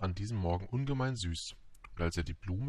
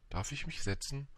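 higher-pitched timid little prince; small, hesitant request; shy question; 6 semitones higher, 4 dB quieter than usual. A narrator's reading. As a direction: ask permission meekly, pitched up. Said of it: "fragte schüchtern der kleine Prinz".

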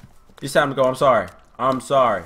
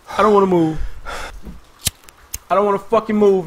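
raised-pitch, pleading repetition; higher, insistent dread; frightened refusal; 6 semitones higher, faster than usual. Real, not acted. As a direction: protest anxiously, voice pushed up. Afraid to move, getting louder.

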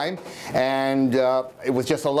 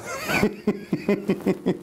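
mad laugh; 6 semitones higher than usual. Acted. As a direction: giggle at your own dark plan. Madman laughs after murder plan.

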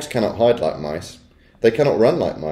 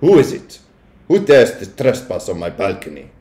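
indignant challenge; 5 dB louder than usual. A narrator's reading. Witch challenging a trespasser on her balcony, indignant. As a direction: challenge the intruder in a put-on witch accent.

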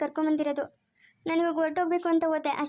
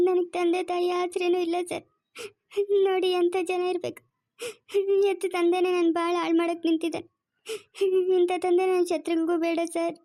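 tearful, higher-pitched sobbing plea; crying; 3 semitones higher than usual. Acted. Rekha crying to the officer about her father. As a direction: weep out the complaint against her father. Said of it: "ಅಳ್ಬೇಡ, ಸುಮ್ನೆ ಇರು ರೇಖಾ".

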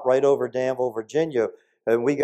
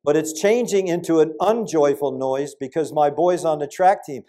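ordinary delivery

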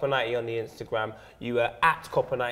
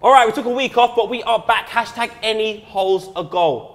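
hyped return; 7 semitones higher, 8 dB louder than usual. Real, not acted. Relaunching the segment loudly.

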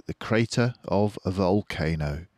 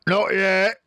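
higher, louder farmer shout; Boggis shouting; 13 semitones higher, 6 dB louder than usual. A narrator's reading. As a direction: yell over the machines. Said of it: "shouted Boggis".

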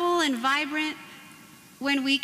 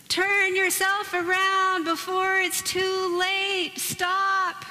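mock warning sign; 7 semitones higher, 3 dB louder, slower than usual. Sign read with mock alarm.

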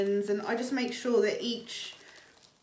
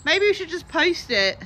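nervous; 8 semitones higher, 8 dB louder than usual. Real, not acted. Afraid of the spider.

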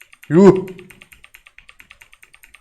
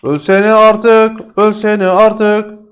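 drawn-out taunt; 6 semitones higher, 4 dB louder, much slower than usual. Pretend.

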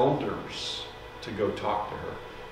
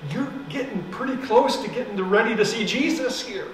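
high, urgent pleading; 7 semitones higher than usual. Retold or imitated. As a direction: beg her to turn back, voice rising. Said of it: "I pleaded with her".

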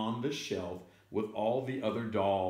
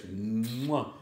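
big smacking "mwah"; playful smooch sound; kiss noise. Acted. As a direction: plant an exaggerated kiss.

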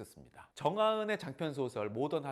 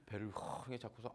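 ordinary delivery

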